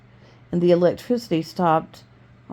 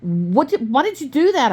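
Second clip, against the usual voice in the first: high-pitched, moderately raised shout of protest; narrated shout; 8 semitones higher than usual. A narrator's reading. Boy to the man who hurt his mother, narrated shout. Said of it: "I shouted".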